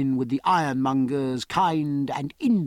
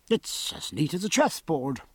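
ordinary delivery, nothing marked

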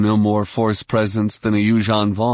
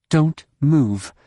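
raised, firm warning; pointed caution; 5 semitones higher than usual. A narrator's reading. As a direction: warn him off in a higher voice. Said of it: "she warned him".

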